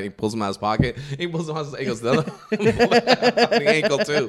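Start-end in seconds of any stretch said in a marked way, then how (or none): 2.0-4.3 high, louder, through laughter; laughing mock impression; laughing quote. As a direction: crack up while quoting the line. Retells scene while cracking up.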